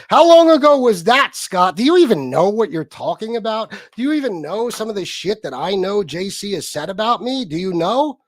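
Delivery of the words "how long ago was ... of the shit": angry demand. Real, not acted. Berating Scott on stream.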